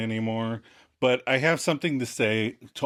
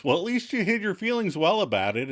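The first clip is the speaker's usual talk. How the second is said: higher-pitched mock grumble; sarcastic grumble; 7 semitones higher than usual. Chandler back at Monica after losing, grumbling. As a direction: imitate a mildly grumbled comeback. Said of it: "And Chandler grumbles".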